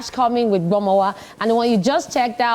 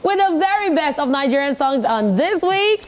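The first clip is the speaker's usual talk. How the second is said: high energetic countdown announcer; radio host; 5 semitones higher than usual. Acted. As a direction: hype the top ten countdown.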